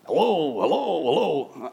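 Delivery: lightly voiced, drawn-out puppet hellos; a hint of a singsong toy tiger; tiger puppet greeting. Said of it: "tiger goes around and".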